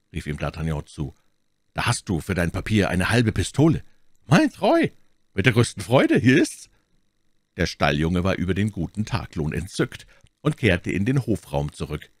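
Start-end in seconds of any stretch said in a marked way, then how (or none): 4.3-6.7 put-on high, loud boyish voice; delighted stable boy. Delighted stable boy takes the reward.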